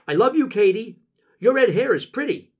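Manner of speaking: high, loud character voice; Jackie speaking up. A narrator's reading. Jackie to Katie on the sidewalk, speaking up high and loud. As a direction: pipe up high with an open compliment. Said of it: "Jackie speaks up".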